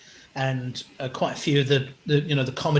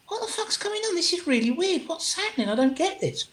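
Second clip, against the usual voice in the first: high-pitched mock bewilderment; bewildered inner voice; 13 semitones higher than usual. Performer puzzled over the pencil, high retold bewilderment. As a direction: voice your own confused thoughts, much higher.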